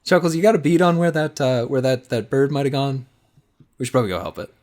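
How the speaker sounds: high, loud eager question; eager call. Acted. Chris urging Chuckles to help find the bird, eager. Character calling to a friend.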